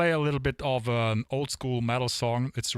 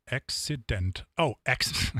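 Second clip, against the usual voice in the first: usual speaking voice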